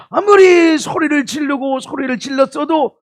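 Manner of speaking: high, loud preacher's shout; full-force sermon cry; preaching shout. Real, not acted. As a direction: belt it out from the pulpit.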